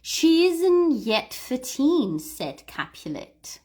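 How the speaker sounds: slow, clear line for repeating; slow model line. Shown as a demonstration. Teacher reading a line slowly for learners to copy. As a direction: read it out slowly for them. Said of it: "Listen and repeat".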